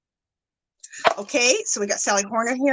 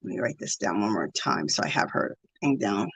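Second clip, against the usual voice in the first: low, soft self-talk; under the breath; 13 semitones lower, 10 dB quieter than usual. Real, not acted. Streamer writing down a donor's name, under her breath. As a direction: murmur it low while busy writing. Talking to herself while writing.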